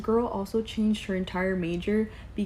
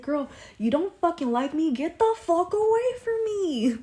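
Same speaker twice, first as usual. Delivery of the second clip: mock telling-off; 10 semitones higher, 6 dB louder than usual. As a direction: yell her away, stretched and high. Retold telling off the coworker.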